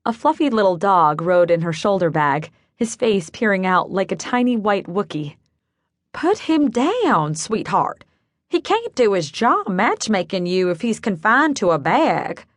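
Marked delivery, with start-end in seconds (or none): 6.1-12.5 put-on Southern accent. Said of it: "In a slightly nasal Southern accent".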